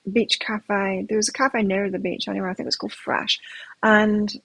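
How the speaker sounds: usual speaking voice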